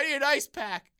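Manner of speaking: high, loud whiny demand. Retold or imitated. Injured friend insisting at the desk, a whiny demand. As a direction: insist like a spoiled diva.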